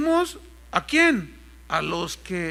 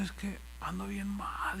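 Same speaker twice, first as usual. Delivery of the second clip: mock miserable pastor; 10 dB quieter than usual. Pastor voiced complaining of toothache.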